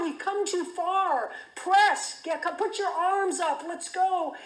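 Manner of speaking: high urgent shouted commands; retold yelling. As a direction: bark it up from the boat to the swimmer. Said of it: "Bonnie's whistling and yelling out".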